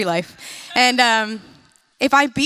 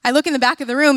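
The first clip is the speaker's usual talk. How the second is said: usual speaking voice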